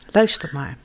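ordinary delivery

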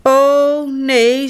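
startled. A narrator's reading. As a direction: gasp it out in alarm. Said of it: "zegt papa Wapper geschrokken". (geschrokken uitroep)

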